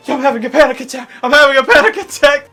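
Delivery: fast, high, loud mock panic; imitating a tourist panicking on the broken boat; mock panic attack. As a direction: blurt it out like you can't breathe.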